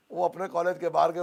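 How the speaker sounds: ordinary delivery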